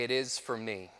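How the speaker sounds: low, soft, unruffled answer; calm reply. Retold or imitated. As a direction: answer rage quietly, without rising to it. Calm reply to furious attacker.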